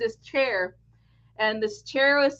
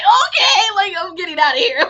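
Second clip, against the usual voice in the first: high, loud panicked escape line; mock panic; 9 semitones higher, 9 dB louder than usual. Fleeing the witch chair, high, loud and panicked. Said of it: "I'm just like".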